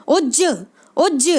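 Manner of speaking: उज्ज broken off on the doubled j; hanging half consonant. Shown as a demonstration. Teacher showing how a half letter sounds, hanging jj. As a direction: say उज्ज alone, leaning on the jj. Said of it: "बोल के देखें".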